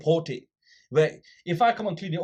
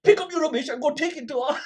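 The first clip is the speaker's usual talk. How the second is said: mock mic shout; 14 semitones higher, 5 dB louder than usual. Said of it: "And he goes".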